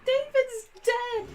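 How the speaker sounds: slow, high, loud mock wail; imitated shocked outburst. Co-host re-enacts a reality show moment, hammed-up shocked cry.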